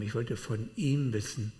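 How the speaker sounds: ordinary talk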